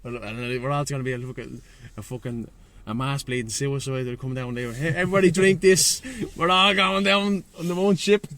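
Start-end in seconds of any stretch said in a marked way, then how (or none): 4.7-8.2 mock cult leader. Mocking the group as a cult.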